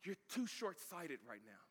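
soft, slow, measured reply; hushed, patient correction; calm Jesus. Acted. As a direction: say it quietly, spacing out the words. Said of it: "Jesus is like".

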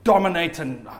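ordinary talk

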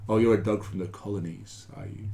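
low, soft, hoity-toity British dog voice; prim and proper British. Acted. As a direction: look down your snout, British and prim. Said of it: "all prim and proper".

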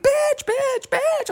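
very high, loud excited squeals; acted elation. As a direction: squeal each word, hammed-up. Acting overjoyed reaction to find.

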